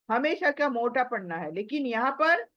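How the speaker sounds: usual speaking voice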